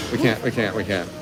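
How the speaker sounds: usual speaking voice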